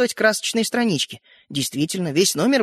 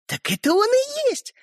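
high, drawn-out gleeful reveal; joyful announcement. A narrator's reading. Sister reveals the swapped toy, joyful. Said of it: "радостно заявила Ариша".

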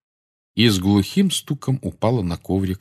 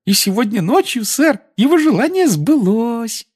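very high eager house-elf; squeaky elf voice; 4 dB louder than usual. Acted. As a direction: chatter on like a devoted elf. Dobby's held voice.

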